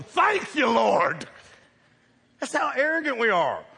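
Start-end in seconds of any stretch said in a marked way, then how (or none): 0.0-1.3 loud, high cry of thanks; joyful praise. Pretend. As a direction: shout thanks to the Lord. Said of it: "I'd have been going".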